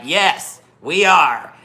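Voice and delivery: loud, high, emphatic reply; retold defiance. Rider answering the exasperated cop, gleefully defiant.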